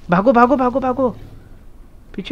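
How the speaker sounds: high-pitched repeated alarm to flee; urgent call. Real, not acted. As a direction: send the team running, now. Calls team to run from dinosaurs.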